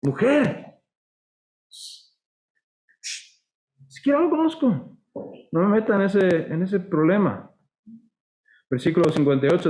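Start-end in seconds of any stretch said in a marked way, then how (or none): 0.0-7.5 Peter brushing her off, pitched much higher; cornered fisherman waving off the accusation, high and urgent; frantic denial. Acted. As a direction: push her away with a sharp, high denial. Peter's denial acted again.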